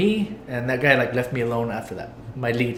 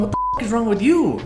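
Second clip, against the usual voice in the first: much higher, mock yelled comeback; retold yelling; 9 semitones higher than usual. Worker retells yelling back at his lead, raised voice. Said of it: "I was yelling at him the way he was yelling at me".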